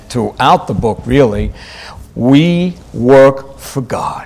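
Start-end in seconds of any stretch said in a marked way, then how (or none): 2.1-4.3 slow, weighty "we work for God"; emphatic. Real, not acted. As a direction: space out "we work for God" word by word. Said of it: "I want to emphasize that point".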